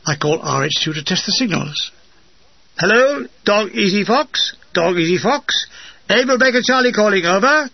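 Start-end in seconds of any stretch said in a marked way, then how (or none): 2.8-7.7 high, measured signaller's call; radio call. A narrator's reading. Signaller calling headquarters to test signals, radio call. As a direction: call clearly over a field radio.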